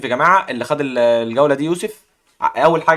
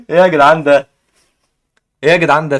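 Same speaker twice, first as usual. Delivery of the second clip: loud disbelief; 8 dB louder than usual. Real, not acted. Reacts to chat with disbelief.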